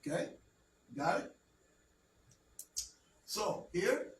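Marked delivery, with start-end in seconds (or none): none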